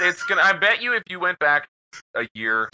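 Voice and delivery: usual speaking voice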